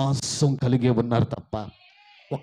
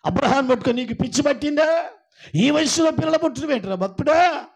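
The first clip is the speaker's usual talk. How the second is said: much higher, loud scornful jeering; loud mockery; 10 semitones higher, 7 dB louder than usual. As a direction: taunt the barren wife with contempt. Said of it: "శారాను పట్టుకొని".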